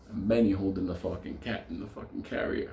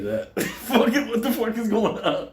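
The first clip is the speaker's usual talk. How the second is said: high, loud, helpless laughter through words; laughing; 10 semitones higher, 4 dB louder than usual. Real, not acted. Teller cracking up at his own cat story, real laughter. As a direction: crack up mid-sentence.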